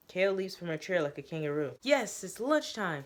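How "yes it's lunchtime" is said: higher, slow, gleeful squeal; excited girl announcing lunchtime. Acted. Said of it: "she squeals".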